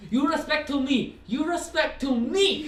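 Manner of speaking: high, loud broken-English teacher in a mock Chinese accent; teacher scolding misbehaving kids, mock Chinese accent. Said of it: "like, a Chinese accent".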